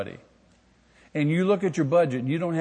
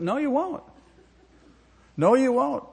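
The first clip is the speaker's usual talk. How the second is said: high emphatic no; 9 semitones higher than usual. Acted. Sharp rebuttal, high pitched.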